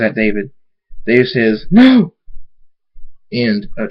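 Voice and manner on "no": very high, loud cry; panicked shout. Acted. Dave as Thunderstorm flies at him, panicked shout. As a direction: yell it out high in alarm.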